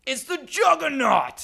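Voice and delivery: high, slow, loud reveal; big booming game-show reveal; dramatic announcer. Pretend. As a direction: announce it like a movie trailer. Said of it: "announcing the answer".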